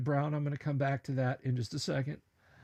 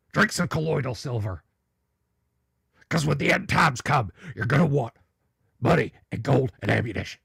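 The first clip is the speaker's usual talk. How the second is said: lightly voiced doomsday host, louder; Alex Jones impression; 5 dB louder than usual. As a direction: warn the people about the end times. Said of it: "I don't bust out the Alex Jones".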